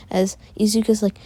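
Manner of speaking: usual speaking voice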